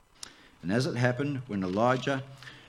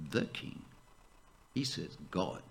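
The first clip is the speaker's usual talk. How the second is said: ordinary delivery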